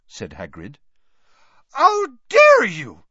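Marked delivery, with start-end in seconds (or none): 1.7-3.0 high, slow outraged shriek. A narrator's reading. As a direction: shriek it like a foghorn. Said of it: "shrieked Madame Maxime".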